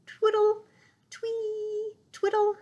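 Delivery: high, tweeting chirps of a songbird; chirped birdsong. Acted. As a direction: chirp it like a little bird. Birdsong imitation.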